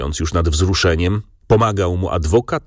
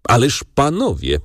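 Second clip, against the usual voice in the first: raised-pitch appeal to the room; exclamation; 6 semitones higher than usual. A narrator's reading. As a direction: call out to the gentlemen, mildly acted. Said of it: "zawołał". (wołanie podwyższonym głosem)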